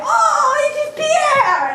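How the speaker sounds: high, drawn-out delighted cry; mock French delight. Staff spotting a colleague, in high drawn-out mock delight. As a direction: sing out the name like gushing French staff.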